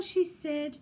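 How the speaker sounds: plain reading voice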